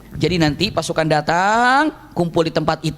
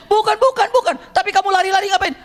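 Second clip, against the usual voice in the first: protesting call; 12 semitones higher than usual. Acted. Abbas questions fleeing Abu Sufyan.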